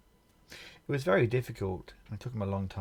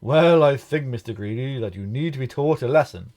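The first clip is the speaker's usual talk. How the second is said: loud stern giant voice, higher and louder; booming giant; 5 semitones higher, 14 dB louder than usual. A narrator's reading. Giant deciding to punish Mister Greedy, booming loud. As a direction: lay down the law like a towering giant. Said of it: "he bellowed".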